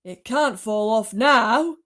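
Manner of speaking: loud, slow shout. A narrator's reading. Aunt shouts that the peach is safe on the ground. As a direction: shout it out relieved. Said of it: "Aunt Sponge shouted".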